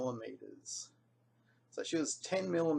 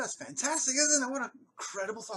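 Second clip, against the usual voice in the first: excited; 11 semitones higher, 5 dB louder than usual. Retold or imitated. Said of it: "I said".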